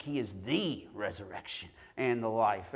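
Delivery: "the" before "resurrection" stretched to "thee"; stress on THE. Shown as a demonstration. Preacher showing word emphasis, long stressed "the" before "resurrection". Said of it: "each word one at a time".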